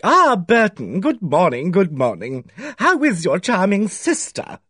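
fussy village bachelor greeting a neighbour with delight; delighted Mr Pye greeting. A narrator's reading. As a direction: gush a welcome to an old acquaintance. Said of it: "greeted me with every evidence of delight".

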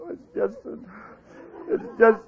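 hammed-up choked-up faltering; mock emotional, trailing into pauses. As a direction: falter softly, as if overcome. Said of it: "I get all choked up".

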